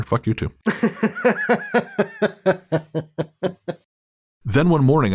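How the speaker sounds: ordinary delivery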